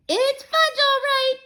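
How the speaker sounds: put-on high, loud little-kid echo; toddler echo. Toddler Fudge repeating his brother's words, high echo. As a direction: parrot it like a small child copying his brother. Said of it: "my brother repeated".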